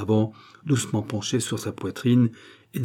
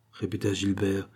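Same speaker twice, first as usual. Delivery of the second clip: plain reading voice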